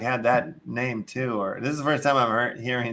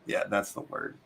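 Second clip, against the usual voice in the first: usual speaking voice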